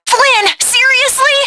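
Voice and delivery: exasperated. Acted. Exasperated at Flynn's flying.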